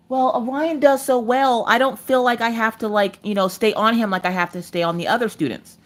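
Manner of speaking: mock-diplomatic schoolteacher; imitated teacher. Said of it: "had to contact the teacher".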